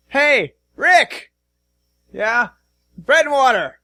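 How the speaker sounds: loud high call across the room. Acted. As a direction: shout for someone in back. Guard calls out to Rick.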